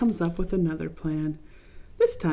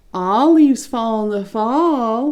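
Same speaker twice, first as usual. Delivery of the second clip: slow, louder singsong taunt; mocking; 4 semitones higher, 8 dB louder, slower than usual. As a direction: tease Leaf as if he should know better. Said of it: "kind of mocking".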